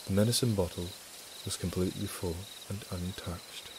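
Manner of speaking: low, soft storyteller's read; story narration. A narrator's reading. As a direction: read it softly, low and measured.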